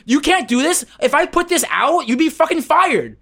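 loud sharp voice. Acted. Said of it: "why are you yelling at me".

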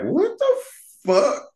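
very high incredulous squeak; mock shocked squeal. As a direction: squeak it out in disbelief. Retelling his own reaction.

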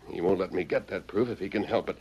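ordinary talk

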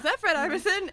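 surprised recognition. Retold or imitated. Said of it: "I was like".

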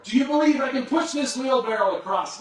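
loud, high call across the falls; yelling to the crowd. Retold or imitated. Tightrope walker shouting to the crowd below, loud and high. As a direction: shout it so thousands can hear. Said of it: "he would yell".

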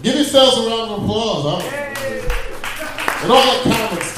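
applause call. Real, not acted. Asking crowd for applause.

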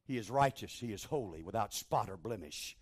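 ordinary delivery

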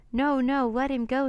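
high, loud urgent protest; pleading storybook outcry; desperate plea. A narrator's reading. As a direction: beg them to release him. Jack begs for the octopus.

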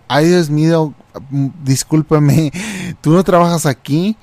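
mock-flustered question, pitch climbing at the end; flustered realization. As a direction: act out an embarrassed, startled apology. Acted embarrassed realization of mistake.

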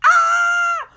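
rising into a high, loud mock scream of disgust; building from a whine to a high, loud mock shriek; frustrated hate rant. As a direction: act out hating someone till it bursts out. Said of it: "you get all frustrated".

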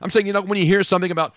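normal talking voice

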